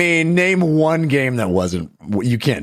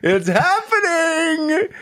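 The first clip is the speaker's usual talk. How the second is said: excited; 14 semitones higher, much slower than usual. Real, not acted. Excited cheer at rumored game.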